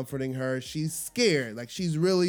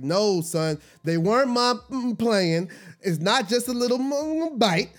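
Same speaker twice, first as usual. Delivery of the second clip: angry retort; 6 semitones higher than usual. Retold or imitated. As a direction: snap back at the man, biting off curses. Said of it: "I'm like".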